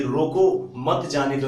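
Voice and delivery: slow "रोको", a break, then "मत जाने दो"; pause after रोको. Shown as a demonstration. Teacher explains that stopping is necessary, pausing after "रोको". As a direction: set "रोको" apart from "मत जाने दो". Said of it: "यहां रुकना जरूरी है".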